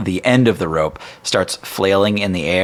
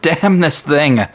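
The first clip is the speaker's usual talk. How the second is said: dwarf curse; 9 semitones higher, 5 dB louder, much slower than usual. Acted. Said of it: "and he goes".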